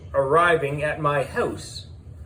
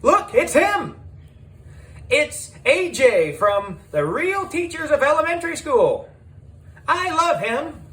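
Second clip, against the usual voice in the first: screaming fangirls; 12 semitones higher than usual. Acted. Fans spotting A J on the street squeal with excitement.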